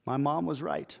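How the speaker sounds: ordinary talk, nothing marked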